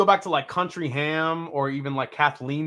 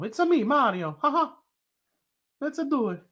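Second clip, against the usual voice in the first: high-pitched cartoon Italian plumber; playful Mario voice, pitched way up; Mario impression; 11 semitones higher than usual. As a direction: put on a squeaky mock-Italian accent. Plays Mario with his catchphrases.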